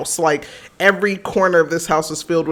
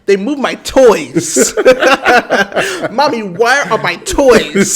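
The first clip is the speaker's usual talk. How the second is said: put-on slow, higher whiny toddler voice with laughter; little son; 5 semitones higher, 5 dB louder, slower than usual. Mom laughs while mimicking son crying about toys. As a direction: whine it like a small boy, laughing. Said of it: "He was like".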